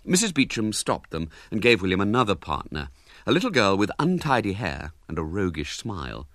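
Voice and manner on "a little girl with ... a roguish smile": plain reading voice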